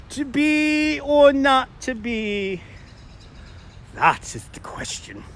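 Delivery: hammed-up British Shakespearean recital; horrible British accent. Shown as a demonstration. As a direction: slowly declaim it in a bad British accent. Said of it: "I will try to read them in a horrible British accent".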